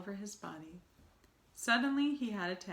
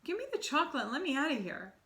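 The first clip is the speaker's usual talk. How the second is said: high, fast, louder demand; suspicious demand; 8 semitones higher, 4 dB louder, faster than usual. Acted. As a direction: rush it out, getting scared. Bruno grows suspicious.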